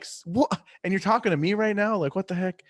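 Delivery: high, incredulous what; stunned, voice jumping up high; mock shocked outburst; disbelief. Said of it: "And I'm like".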